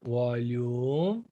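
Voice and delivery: low soft words with a rising end. Shown as a demonstration. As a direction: drop the pitch and soften. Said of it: "Verbal communication".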